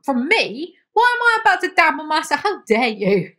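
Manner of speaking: loud, high, indignant outburst; outraged. Real, not acted. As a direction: blow up at the hypocrisy. Outraged reaction to Tobias.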